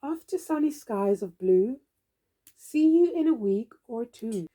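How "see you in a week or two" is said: ordinary delivery, nothing marked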